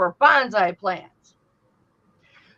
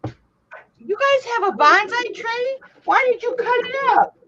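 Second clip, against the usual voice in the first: high, slow puzzled voice, pitched way up; acting out her own confusion; mock baffled self; 11 semitones higher than usual. Aunt telling her nephew's family, high puzzled voice. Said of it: "And I'm like".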